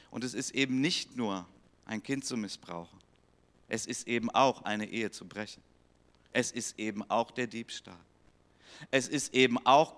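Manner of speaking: usual speaking voice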